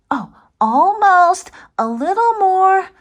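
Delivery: high, loud startled cry; high loud surprised creature voice; shocked Larry. Acted. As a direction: yelp in cartoon shock. Larry answering.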